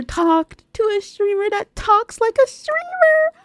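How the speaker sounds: breaking into ecstatic shouting; overjoyed celebration. Acted. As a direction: build to a high, loud squeal. Celebrating talking like a streamer.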